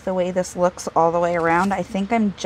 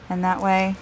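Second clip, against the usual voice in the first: ordinary talk, nothing marked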